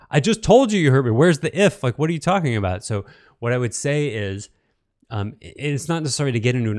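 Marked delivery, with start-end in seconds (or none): 0.1-2.8 higher and louder, exasperated; indignant. Real, not acted. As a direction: let the frustration out, voice up. Indignant at the if apology.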